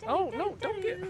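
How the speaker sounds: squeaky high cooing alarm; sing-song oh no. Real, not acted. Baby grabbing something.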